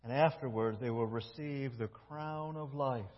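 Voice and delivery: soft, low, intimate preaching; soft closing sermon. Acted. Preacher closing the message to the church, soft and intimate. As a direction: bring them in close, quietly.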